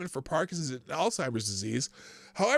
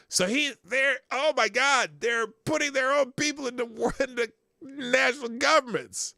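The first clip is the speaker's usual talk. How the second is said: high, loud incredulous outburst; mock outrage; 9 semitones higher, 5 dB louder than usual. Host reacting to the planted leaders, loud high disbelief. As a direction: exclaim in exaggerated shock, pitched way up.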